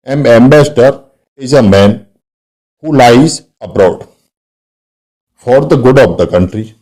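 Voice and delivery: slow, emphatic dictation pace; teacher reading the pun aloud to the class, slowly and clearly; slow example read. Shown as a demonstration. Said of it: "teacher reads example slowly".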